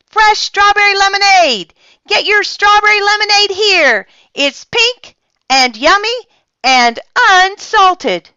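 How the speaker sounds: high, loud street-vendor cry; calling out. A narrator's reading. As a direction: hawk lemonade to the whole street. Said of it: "calling out".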